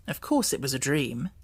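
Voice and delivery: plain reading voice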